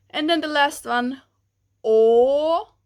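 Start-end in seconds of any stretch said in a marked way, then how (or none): 1.8-2.7 loud, open-mouthed "o"; open vowel. Shown as a demonstration. Teacher projecting the third vowel to class. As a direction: open wide on "o". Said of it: "Here you open your mouth a little bit more".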